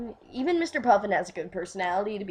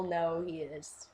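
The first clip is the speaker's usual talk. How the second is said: ordinary delivery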